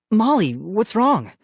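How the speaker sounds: demanding. A narrator's reading. Brother demanding to know what upset his sister, demanding. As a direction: demand an answer. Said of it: "Ian demanded".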